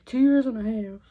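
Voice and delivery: emphatic loud. Real, not acted. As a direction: punch it out, insisting. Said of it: "I'm not gonna lie to you".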